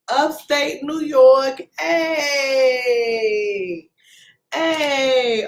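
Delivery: slow, stretched celebratory call-out; drawn-out cheer. Real, not acted. Host hailing upstate New York viewers, drawn-out cheer. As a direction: cheer them on, stretching the vowels.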